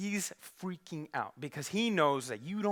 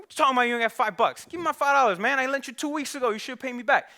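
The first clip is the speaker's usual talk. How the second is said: high, loud, heated; angry demand; 6 semitones higher, 5 dB louder than usual. Acted. Luke angrily demands his five dollars from Johnny, high and loud. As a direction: get in his face about the money.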